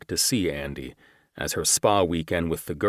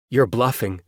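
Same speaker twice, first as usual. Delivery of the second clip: scoffing man; 6 semitones higher, 5 dB louder than usual. Acted. Antagonist scoffing.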